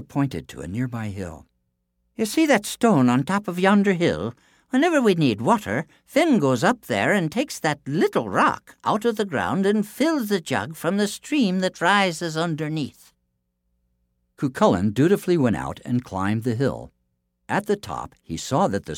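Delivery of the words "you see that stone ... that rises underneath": Una's voice. A narrator's reading. Una instructing giant.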